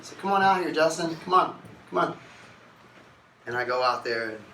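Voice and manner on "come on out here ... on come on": loud, high beckoning; calling out. Retold or imitated. Family calling him out to the intervention, loud. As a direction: call him out of the room.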